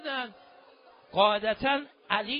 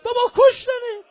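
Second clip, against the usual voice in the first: much higher, louder, stunned shout; disbelieving cry; 13 semitones higher, 11 dB louder than usual. Acted. Father stunned by his son's death, crying shout. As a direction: cry it out as if you can't believe it.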